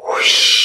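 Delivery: airy, voiceless gust sound; whooshing wind. A narrator's reading. Gust blows from an open window, whooshed by the storyteller. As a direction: blow it out like wind. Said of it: "a gust of wind blows".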